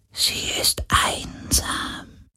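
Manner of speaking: very slow, soft whisper; sad whisper. Acted. Ghost tells Galen the Helix is lonely, slow whisper. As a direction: share a lonely truth.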